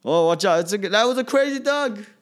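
mock hyped teammate. Mocking bench players at a dunk contest, hammed-up high excitement. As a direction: ham up bench players going wild. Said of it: "the reactions are just, ugh".

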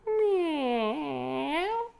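high, wailing cat mew; crying meow. Acted. Cat partner whines back at the old cop.

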